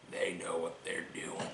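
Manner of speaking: near whisper. Acted. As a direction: drop it to a hushed warning. Repeated ominous line.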